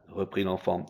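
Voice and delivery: ordinary delivery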